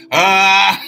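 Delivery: loud, high "ah" into laughter; big laugh. Real, not acted. Host cracking up at own tassel joke, laughing. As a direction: open with a high shout, then laugh.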